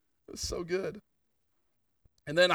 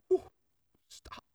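whispered, barely voiced; overwhelmed whisper in prayer; breathless whisper. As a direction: let the words fall away to a whisper. Overwhelmed by God's presence in prayer.

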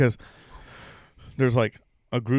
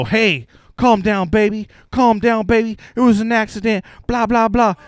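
high-pitched mock soothing; imitated pleading; 6 semitones higher than usual. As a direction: imitate someone trying to talk her down, pitched high. Retold man trying to calm woman.

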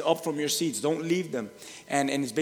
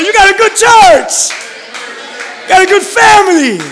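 shouted prompt; 18 dB louder than usual. Pretend. Pastor giving the congregation words to shout. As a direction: bellow it out for them to echo. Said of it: "Look at that person next to you and say".

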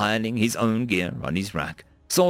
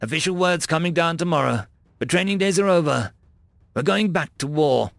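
bold, higher-pitched military orders; confident squad sergeant; 7 semitones higher, 3 dB louder than usual. A narrator's reading. Bradford preparing the squad for combat, commanding. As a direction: issue orders briskly with confidence.

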